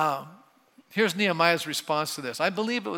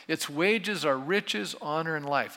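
slow solemn reading; slower than usual. Shown as a demonstration. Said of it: "let's stop there for a second".

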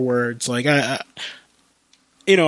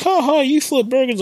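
mocking taunt; 11 semitones higher, 3 dB louder than usual. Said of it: "most people are like".

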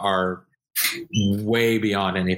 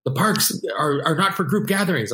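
mock park busybody; 8 semitones higher than usual. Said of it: "and he's like".

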